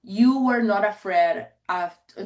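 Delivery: ordinary talk, nothing marked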